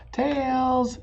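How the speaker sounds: high excited call of tails; shouting tails. Acted. Amelia Bedelia shouting her call at the coin toss. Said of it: "shouted Amelia Bedelia".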